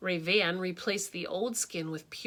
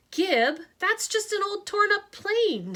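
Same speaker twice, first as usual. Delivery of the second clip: put-on higher dismissive kid's voice; dismissive boy; 12 semitones higher, 6 dB louder than usual. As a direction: brush it off like a bored kid.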